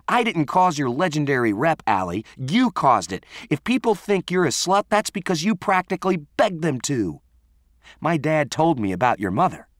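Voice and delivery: high, forceful accusations; angry tirade. Acted. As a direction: tear into a friend, pitch climbing. Renee's angry tirade in acted quarrel.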